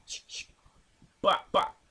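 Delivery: imagining shooting the bull, gun noises; mock gunshots. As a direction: make gun-cocking and shot noises. Imitating gun sounds.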